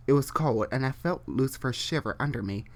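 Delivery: ordinary delivery, nothing marked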